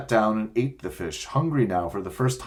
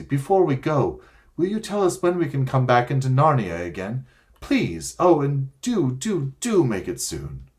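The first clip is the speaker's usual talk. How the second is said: put-on higher, eager little girl asking Aslan; a child's hopeful plea, pitched up; young girl pleading; 6 semitones higher, 3 dB louder than usual. A narrator's reading. Lucy begging Aslan for the way home, girlish and pleading.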